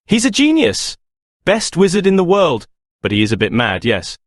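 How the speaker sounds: light, breezy prefect tone; airy. A narrator's reading. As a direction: toss it off lightly. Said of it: "said Percy airily".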